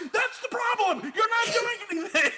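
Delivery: very high mock shouting; imitating a panicked friend at high pitch; retold exasperated cry. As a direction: plead frantically, hammed up. Retells friend's exasperated pleading.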